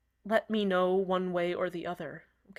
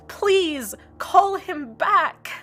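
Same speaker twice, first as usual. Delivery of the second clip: pleading; 10 semitones higher, 7 dB louder, much slower than usual. Acted. Pleading end of the rant.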